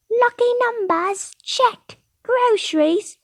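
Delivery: very high-pitched put-on voice; squeaky-high customer ticking off items; patron character. Patron checks errands leaving the fortune teller, very high voice.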